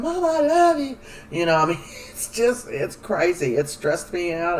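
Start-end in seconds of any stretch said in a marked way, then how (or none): 0.0-1.0 high, strained crying cry; high mock-tearful cry; imitating crying plea. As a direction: wail it like a scared daughter. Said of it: "Chanel's crying".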